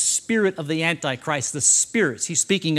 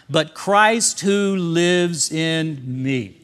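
very slow, word by word; leading group recitation; 3 dB louder, much slower than usual. Shown as a demonstration. Preacher leads congregation saying scripture out loud, slow recitation. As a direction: stretch each word so all can join. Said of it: "say this with me out loud".

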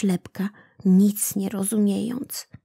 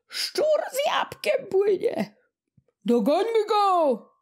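fisherman calling out; 12 semitones higher than usual. A narrator's reading. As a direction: shout to your mates across the boat. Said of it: "zawołał inny".

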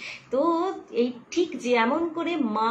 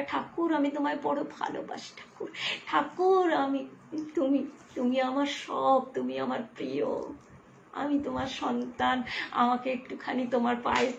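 heartfelt prayer; 6 dB quieter than usual. Shown as a demonstration. Heartfelt prayer to Thakur demonstrated.

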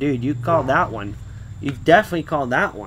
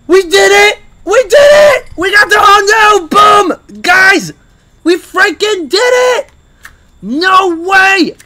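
screaming, far higher and louder; ecstatic screaming; 13 dB louder, slower than usual. Real, not acted. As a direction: scream with disbelief and joy. Wins the Hondo.

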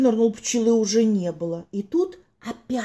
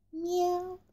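soft meow; 7 semitones higher, 11 dB quieter than usual. Acted. Mysterious meow at home.

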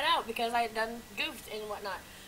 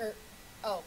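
usual speaking voice

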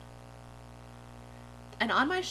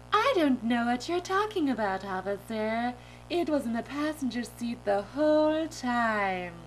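louder, put-on innocence; silly dream-self voice; 2 semitones higher, 3 dB louder than usual. Driver making excuses to the officer, goofy innocent voice. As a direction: protest innocence, drawing out the end. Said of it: "I'm talking like this".

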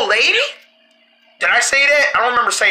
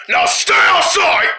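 shouted order; 7 semitones higher, slower than usual. Acted. Kicking old man out.

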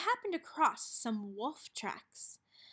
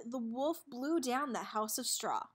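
ordinary delivery, nothing marked

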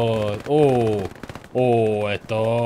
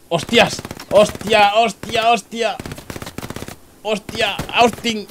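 high, urgent repeated cries; alarmed exclamation; 10 semitones higher, 3 dB louder, much slower than usual. Real, not acted. Gamer reacting to sudden danger, alarmed. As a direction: blurt it out in rising alarm.

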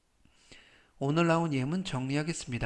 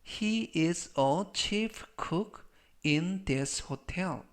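very slow, word by word; slow model reading; 3 semitones higher, much slower than usual. Shown as a demonstration. Teacher models the hotel chef sentence for learners, slow model reading. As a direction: say each word slowly for learners to repeat.

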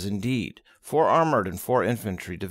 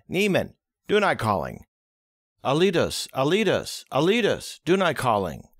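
repeated radio call, rising higher; 4 semitones higher than usual. Acted. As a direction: call into the radio, more urgent each time. Radio operator calling again and again.

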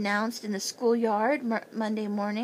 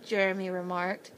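ordinary delivery, nothing marked

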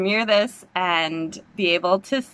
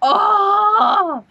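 much higher, louder overwhelmed cry; excited cry; 12 semitones higher, 5 dB louder than usual. Real, not acted. Reacting live to trailer.

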